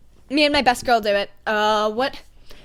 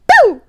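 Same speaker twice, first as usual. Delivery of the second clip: mock impact; 13 semitones higher, 11 dB louder than usual. Sound of skate stabbing foot.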